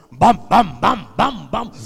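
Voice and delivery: loud booming thunder sounds; thunder booms. Retold or imitated. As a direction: boom out like thunder.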